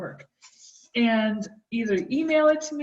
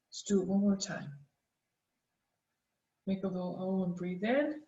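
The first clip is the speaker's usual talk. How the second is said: guided breathing; 4 dB quieter than usual. Acted. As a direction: calmly lead the breathing exercise. Leads a snake breath mindfulness exercise.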